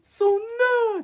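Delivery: high, loud wailing "そんなー"; mock wail. Told by YouTube about the money, loud mock wail. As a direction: wail it like a crushed kid. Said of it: "ってなって".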